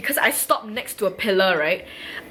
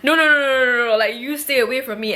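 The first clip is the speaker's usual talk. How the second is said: alarmed refusal; 4 semitones higher, faster than usual. Retold or imitated. Retelling herself warding off supposed scammers, alarmed refusal. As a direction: fend them off in mock alarm.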